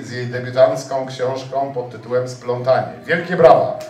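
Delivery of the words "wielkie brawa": calling out. Real, not acted. Host calls for applause.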